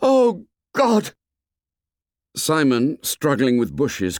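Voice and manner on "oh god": high anguished cry. A narrator's reading. Boy agonized by indecision as the ship passes, anguished outcry. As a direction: cry out in agony, louder and far higher. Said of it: "Ralph cried out".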